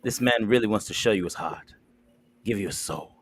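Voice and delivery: low, slow, seductive host read; smooth dating-show pitch. Acted. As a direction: sell him like a late-night dating ad.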